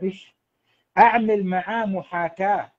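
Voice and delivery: ordinary delivery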